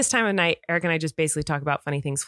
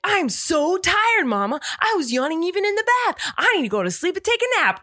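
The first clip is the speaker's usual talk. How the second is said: put-on much higher, eager little-boy voice, stretching the big words; excited toddler; 11 semitones higher, 3 dB louder than usual. Hyper son tells mom about yawning, high kid voice. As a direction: sound wide awake while insisting you're exhausted. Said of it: "He's like".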